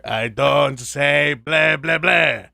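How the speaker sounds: put-on very slow, drawn-out vampire accent; hammy exasperated vampire; Dracula. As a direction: drag out every "blah" like a fed-up cartoon vampire. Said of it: "so I always hear".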